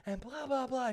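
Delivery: high-pitched mock indignant rant; whiny mock tirade of an entitled customer; imitated yelling. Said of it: "She's, like, yelling at it".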